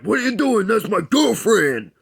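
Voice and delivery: high, loud, indignant outburst; angry boyfriend. Retold or imitated. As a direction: bark it like a jealous jock. Angry boyfriend confronts the kisser.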